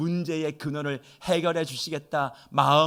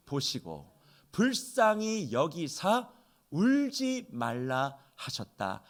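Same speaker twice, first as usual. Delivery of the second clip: slow, hushed reading of the verse; slow scripture reading; 4 dB quieter, much slower than usual. Shown as a demonstration. Pastor reading the verse to the congregation, slow and hushed. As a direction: read each word slowly and softly. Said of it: "십삼 절에 이렇게 말씀하세요".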